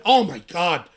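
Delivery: mock clutch-the-pearls outcry; mock shocked outrage. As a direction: play the scandalised critic, overdone. Mocking outrage over a handshake.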